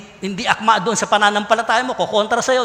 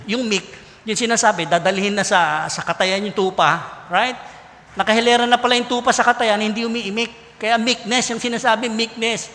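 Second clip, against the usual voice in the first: usual speaking voice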